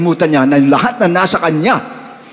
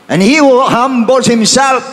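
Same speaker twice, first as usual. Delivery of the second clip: much higher, louder, slow proclamation; proclaiming scripture; 11 semitones higher, 5 dB louder, slower than usual. Acted. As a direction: boom the verse out like a warning. Reads verse at peak. (malakas at mataas na pagpapahayag ng talata)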